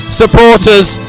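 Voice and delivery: ordinary talk, nothing marked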